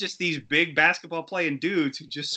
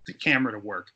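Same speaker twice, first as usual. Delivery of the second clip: ordinary talk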